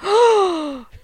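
high, sharp intake of breath; big theatrical gasp; mock gasp. Reacting to co-host's next-Doctor theory, mock shocked gasp.

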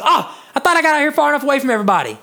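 bitter man in hell. Acted. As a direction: rattle it off like a man sick of his neighbors.

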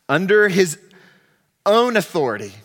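emphatic, projected sermon delivery; forceful preaching. Real, not acted. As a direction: drive it home to the congregation, loudly.

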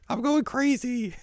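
very high, drawn-out comic wail; mock upset. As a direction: wail it like an overwrought heroine. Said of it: "she gets upset 'cause she's like".

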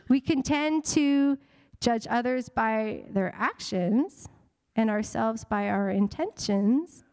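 slow, weighty, word by word: "We can tend to judge others by their actions"; slow emphatic. Shown as a demonstration. Pastor pressing a key truth on the congregation, slow and deliberate. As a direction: let each phrase land slowly. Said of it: "Can I say that again?".

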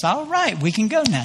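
high, sing-song and overly sweet; mock cheery. Pastor waiting in car for his late family, mock cheery. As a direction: fake patience with a smile.

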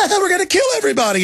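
high giggling mockery; laughing while mocking. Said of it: "get off on the thrill of".

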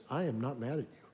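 soft, quiet and steady, eye to eye; sincere reassurance. Retold or imitated. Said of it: "I could tell she was fully telling me the truth".

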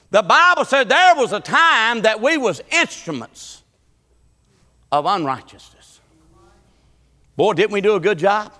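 high, loud preaching cadence; preaching at full force. Acted. Preacher calling out to his congregation in full preaching voice.

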